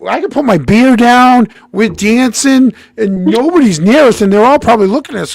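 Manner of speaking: high, loud, acted-out objection; animated protest. Friend argues for keeping their own section, animated loud protest. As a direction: argue it big, pitched well up.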